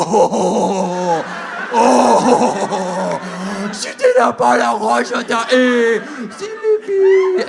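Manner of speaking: high, loud wailing fake French; hammed-up nonsense French comeback; mock French outburst. Fake French comeback in shouting match.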